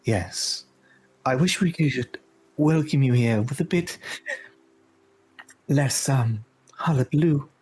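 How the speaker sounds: lightly voiced regal fairy queen; Titania. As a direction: welcome a guest with measured, halting courtesy. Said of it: "Titania turns and says".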